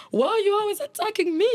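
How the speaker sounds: high-pitched imitation of the husband's baffled question; Riley, baffled. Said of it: "Riley's like".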